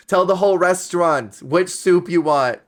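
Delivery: loud, high, laughing announcement; hammed-up waiter on a microphone; mock announcer call-out. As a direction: shout it to the whole room with a laugh. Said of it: "they pull a microphone and they're like".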